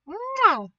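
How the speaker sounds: loud smacking air kiss. Real, not acted. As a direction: blow a big kiss. Blowing a kiss.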